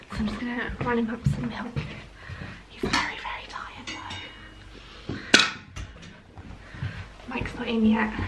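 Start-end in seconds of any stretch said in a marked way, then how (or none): 2.7-5.2 whisper. Real, not acted. Child falling asleep nearby.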